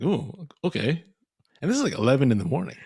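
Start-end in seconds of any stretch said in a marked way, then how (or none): 0.0-1.0 slow, drawn-out mock intrigued ooh okay. Retelling seeing a new voicemail, slow mock intrigued. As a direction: stretch it out, teasingly curious. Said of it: "I was like".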